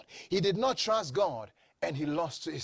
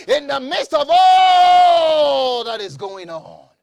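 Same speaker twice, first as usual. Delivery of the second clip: full-force preaching; 6 semitones higher, 10 dB louder than usual. Real, not acted. Preacher urging the church to say it is well, full-force preaching. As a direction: rouse them in loud, high bursts.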